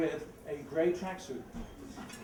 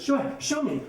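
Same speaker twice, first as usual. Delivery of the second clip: eager lady; 8 semitones higher, 7 dB louder than usual. Retold or imitated. Eager dry cleaning lady.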